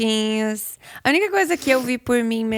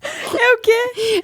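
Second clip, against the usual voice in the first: high surprised question; 12 semitones higher, much slower than usual. Real, not acted. Amused disbelief.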